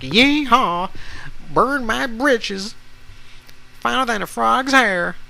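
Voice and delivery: high-pitched, hammed-up southern twang. Shown as a demonstration. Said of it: "It's not stereotypical, um, southern accent".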